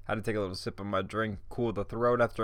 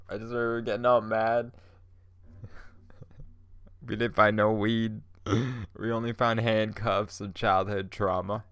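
normal talking voice

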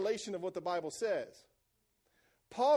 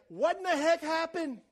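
higher, stunned disbelief, half-shouted; shocked exclaim; 7 semitones higher, 4 dB louder than usual. Retold or imitated. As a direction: cry out as if you can't believe it. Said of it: "He's like".

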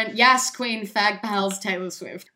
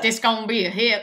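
put-on Nashville accent; 4 dB louder than usual. Co-host mimics the record label boss, Nashville accent. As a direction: echo it in a mock Nashville accent. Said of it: "'Cause it's Nashville".

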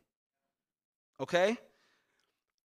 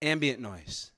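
much softer, low murmur; going quiet; 11 semitones lower, 10 dB quieter than usual. Real, not acted. Pastor hushing so the room hears the noise, very soft. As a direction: drop right down so the noise shows. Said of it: "I'll be quiet for a half second".

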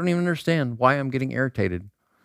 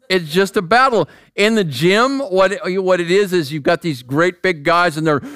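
ordinary talk, nothing marked

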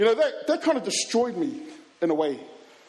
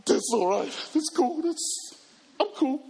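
faking fine; 6 semitones higher, slower than usual. Retold or imitated. Heartbroken, pretending he's fine.

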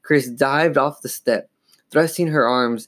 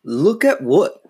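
slow, higher-pitched baffled cry; exasperated cry; 6 semitones higher, 4 dB louder, slower than usual. A narrator's reading. Father baffled by his daughter's pointing, crying out. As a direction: cry out a puzzled question. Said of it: "Mister Powell cried".

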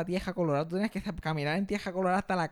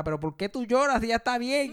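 mock scolding grandma; 6 semitones higher, 4 dB louder than usual. Grandma scolding crying dad.

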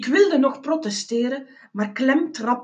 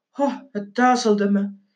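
usual speaking voice

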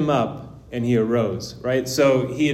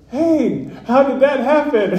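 laughing amazement; 12 semitones higher, 7 dB louder than usual. Retold or imitated. Amazed lady asks, laughing.

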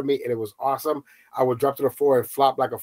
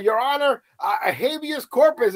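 nervous plea; 10 semitones higher than usual. Retold or imitated. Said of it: "I'm like".

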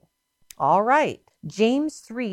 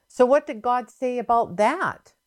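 normal talking voice

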